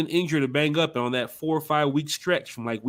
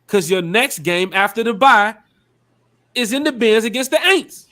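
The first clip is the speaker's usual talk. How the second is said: hyped up; 7 semitones higher, 8 dB louder than usual. Real, not acted. Hyping Saints rivalry.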